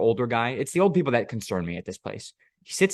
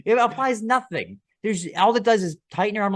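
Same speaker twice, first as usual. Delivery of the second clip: incredulous; 10 semitones higher than usual. Real, not acted. Incredulous rant.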